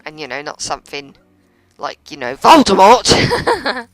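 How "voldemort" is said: hammed-up cry, much higher and louder, drawn out; mock dramatic cry. Gamer joking about a far scarier worst fear, hammed-up cry. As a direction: blurt the name out in slow, pretend horror. Mock dread of a villain's name.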